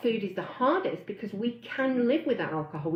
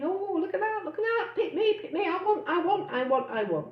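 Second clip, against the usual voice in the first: put-on much higher, childish pleading "pick me, I want"; whiny greedy monkey; 9 semitones higher than usual. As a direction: beg like a spoilt little monkey. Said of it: "Your monkey, it's in full flight. It's going".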